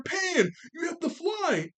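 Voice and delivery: high, slow mock yell. Krillin yelling at Pan to fly, mock yell. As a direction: call it out like a coach urging. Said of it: "Krillin was yelling at her".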